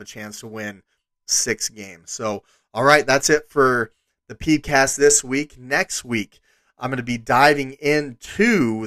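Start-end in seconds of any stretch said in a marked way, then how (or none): none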